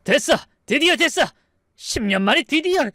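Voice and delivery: high, exultant cry; gleeful triumph. Acted. Eldest brother hearing the heir is born, gleeful. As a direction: crow with triumph, pitch way up. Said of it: "첫째 형님이 크게 웃었어요".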